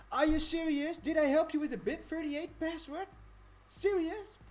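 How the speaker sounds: can't believe the news, burst out; surprised delight. Real, not acted. Reacting to a viewer the video helped, amazed and loud.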